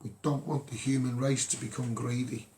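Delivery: normal talking voice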